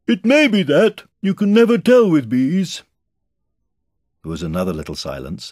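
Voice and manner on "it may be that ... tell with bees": high, loud bear voice; calling down. A narrator's reading. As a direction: pronounce it loudly from up high. Pooh calls down from balloon.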